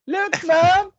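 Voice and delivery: high-pitched mock child voice; hammed-up, high whine; child calling Mom. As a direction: wail like a stung kid. Acting a child attacked by wasps.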